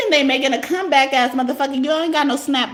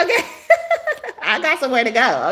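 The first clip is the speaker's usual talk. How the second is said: ordinary talk, nothing marked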